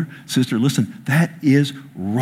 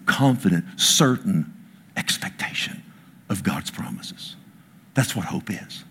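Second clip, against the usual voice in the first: soft hushed preaching; 5 semitones lower, 5 dB quieter than usual. Real, not acted. Preacher softens on hope.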